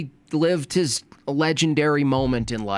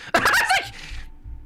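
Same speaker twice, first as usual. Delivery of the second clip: high airy laugh; breathy laugh. Real, not acted.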